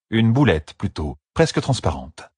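ordinary delivery, nothing marked

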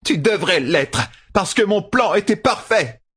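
high-pitched enraged shout. A narrator's reading. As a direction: shriek in outrage at a ruined plan. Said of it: "cria la traqueuse". (cri de rage suraigu)

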